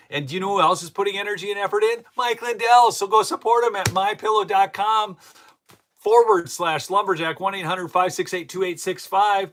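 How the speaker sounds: ad read. Acted. Host reads a pillow sponsor ad to viewers, upbeat. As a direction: sell the product with upbeat energy.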